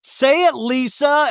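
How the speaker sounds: high, drawn-out wailing demand; mock screaming. Recapping Angie as Whitney holds her back, mock screaming. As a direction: imitate someone shrieking for an answer. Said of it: "Angie's still screaming".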